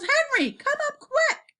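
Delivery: very high, drawn-out call; yelling. A narrator's reading. Mummy calling Henry to come up, high yell. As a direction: holler upstairs-style, high and stretched. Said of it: "and she yells".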